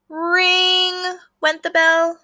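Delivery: high, loud, slow bell ring. A narrator's reading. Teacher voicing the school bell, loud and drawn out. Reading sound word aloud.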